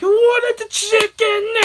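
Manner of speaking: slow, high, loud parody of an angry shout; mocking scream. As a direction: exaggerate the dad's yell to tease. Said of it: "making fun of it to my friend".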